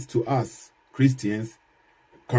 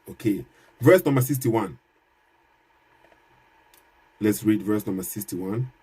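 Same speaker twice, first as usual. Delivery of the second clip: ordinary delivery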